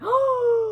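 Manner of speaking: high acted gasp of realization; shocked gasp. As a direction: gasp as if it just hit you. Acts out the sudden realization.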